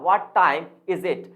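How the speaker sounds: slow question with "time" leaned on; stress on time. Shown as a demonstration. Teacher repeating the word stress example slowly, stress on "time". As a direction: lean hard on "time", measured pace. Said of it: "time पे focus किया".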